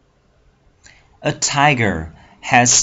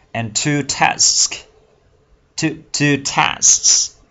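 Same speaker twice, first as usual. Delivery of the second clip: two tusks stretched very slowly, a little higher; slow model; 5 semitones higher, much slower than usual. Shown as a demonstration. Teacher models two tusks very slowly for the class. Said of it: "teacher slows to model tusks".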